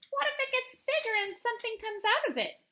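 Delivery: high, small voice fretting over the tree; a child's anxious what-if; worried little girl. Acted. Wimberly voicing a worried question.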